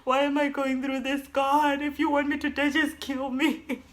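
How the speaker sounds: high, tearful plea to God; desperate prayer. Retold or imitated. To God during labor, high tearful pleading. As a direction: beg God with a laughing sob.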